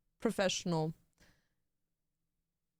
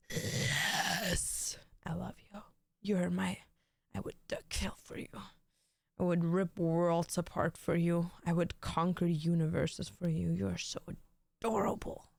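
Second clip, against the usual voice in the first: whispered pet talk; 5 semitones lower than usual. Real, not acted.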